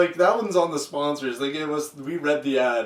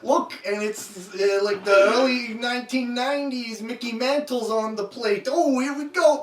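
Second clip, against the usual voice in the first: play-by-play; 4 semitones higher than usual. Pretend. Mock baseball commentary.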